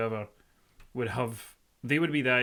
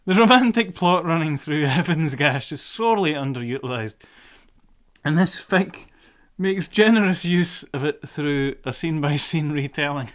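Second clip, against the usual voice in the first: reading through giggles, louder; laughing while reading; 3 semitones higher, 8 dB louder than usual. Real, not acted. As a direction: still cracking up mid-sentence. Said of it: "that broke me for a minute".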